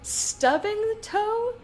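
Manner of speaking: high, slow disbelieving question; incredulous. Real, not acted. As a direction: ask it in disbelief. Incredulous repeat.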